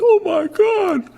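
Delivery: high, loud, drawn-out panicky cry; mock panicked crewman. Imagined crewman panics at his pilot with a high cry. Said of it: "could you imagine if you're a crewman".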